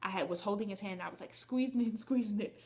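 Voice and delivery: usual speaking voice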